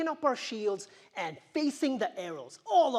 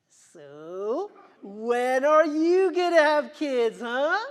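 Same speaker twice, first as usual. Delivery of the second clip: nosy friend voice; 5 semitones higher, 12 dB louder, slower than usual. Retold or imitated. Nosy friends quoted at showers.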